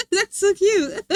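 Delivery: high, loud gush between laughs; cuteness aggression. Retold or imitated. Reacting to cute accents, high laughing gush. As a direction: squeal it, overcome by cuteness. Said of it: "I get cuteness aggression".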